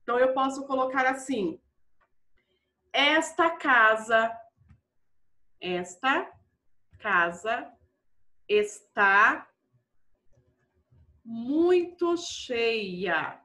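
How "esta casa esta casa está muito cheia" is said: word by word with long pauses; slow dictation. Shown as a demonstration. Teacher dictating an example sentence to pupils, slow and spaced out. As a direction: dictate slowly, leaving time to write.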